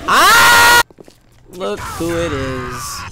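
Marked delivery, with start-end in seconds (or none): 0.1-0.8 sharp high loud ah; startled scream. Real, not acted. Gamer jolted by an attack, startled scream. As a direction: scream from a jump scare.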